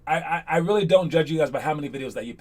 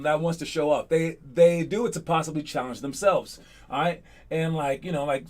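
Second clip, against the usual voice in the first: ordinary talk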